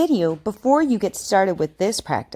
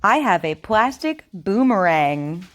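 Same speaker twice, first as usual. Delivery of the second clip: over enunciating. Shown as a demonstration. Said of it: "Repeat after me".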